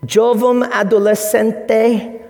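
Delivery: slow, careful Portuguese "Jovem adolescente"; Portuguese pronunciation. Shown as a demonstration. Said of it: "you can laugh at my Portuguese pronunciation".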